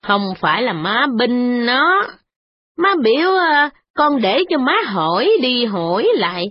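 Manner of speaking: put-on a frowning old lady brushing off the complaint; frowning old mother. Mother-in-law answering her son's wife, frowning and fretful.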